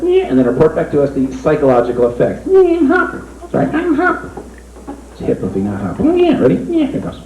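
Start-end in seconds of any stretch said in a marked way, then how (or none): 2.5-4.3 high squeaky rabbit puppet. Acted. Ventriloquist voicing his rabbit puppet, high puppet voice. 6.1-7.2 high squeaky rabbit puppet. Acted. Ventriloquist voicing his rabbit puppet, high puppet voice.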